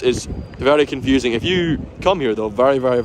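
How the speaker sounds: usual speaking voice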